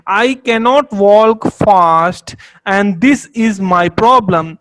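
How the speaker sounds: very slow, loud demo sentence; slow example read. Shown as a demonstration. Teacher reading an example sentence slowly and loudly to students. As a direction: spell the example out word by word. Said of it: "example एक लेते हैं".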